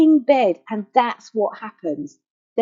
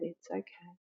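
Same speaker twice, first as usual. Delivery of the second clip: soft, hushed comfort to a hurting part; tender reassurance; 5 semitones lower, 15 dB quieter than usual. Shown as a demonstration. Whispering comfort to the cushion held in bed. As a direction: soothe it gently like a child in your arms. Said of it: "just telling it exactly what we said".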